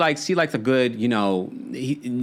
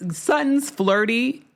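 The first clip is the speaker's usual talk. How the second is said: incredulous; 10 semitones higher, much slower than usual. Real, not acted. Scoffing at Erica's claim.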